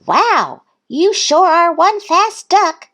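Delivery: high, impressed call; calling after. A narrator's reading. Duck calling after Thistle, high admiring call. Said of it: "Duck called after her".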